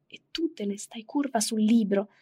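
usual speaking voice

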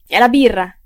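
loud, emphatic shout. A narrator's reading. Turkey blames the beer for his colleague's benevolence, shouting. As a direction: cry it out loudly. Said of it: "gridò Turkey".

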